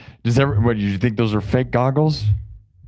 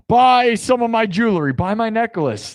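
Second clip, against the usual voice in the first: grandma voice; 11 semitones higher than usual. Pretend. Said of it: "I'm channeling your grandma".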